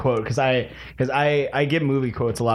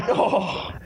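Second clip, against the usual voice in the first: laughing in disgust; 7 semitones higher than usual. Real, not acted. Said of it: "that might have been vomit".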